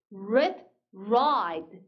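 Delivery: rid with short i, then ride with long "aɪ"; contrasting vowel pair. Shown as a demonstration. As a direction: set "rid" and "ride" side by side, vowel clearly changing. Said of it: "쌍으로 한번 읽어볼까요". (짧은 i와 긴 아이를 대비해서)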